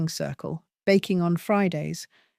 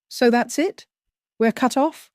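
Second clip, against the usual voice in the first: higher-pitched, rising disbelief, lightly cracked; a mild, narrated crack of hurt on a raised pitch; 6 semitones higher than usual. A narrator's reading. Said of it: "Carla's voice cracked".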